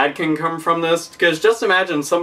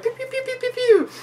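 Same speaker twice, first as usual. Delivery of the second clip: much higher zappy pew pew; laser pew sounds; 4 dB quieter than usual. Pretend. Imitating a shoot-'em-up game, much higher pew pew lasers. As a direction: fire off little arcade lasers.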